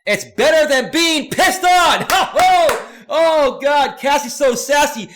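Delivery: high, loud shout breaking into laughter; uproarious delight. Real, not acted. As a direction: crack up while yelling out the joke. Cracks up reading a viewer's joke.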